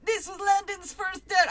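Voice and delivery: hammed-up Jamaican accent, high and loud; a mock Jamaican accent. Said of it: "now he's Jamaican".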